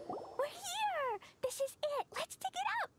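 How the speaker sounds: high, eager calling out; excited. Acted. Glee excitedly announcing arrival to friends, high and eager. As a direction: bounce it out, thrilled to dig.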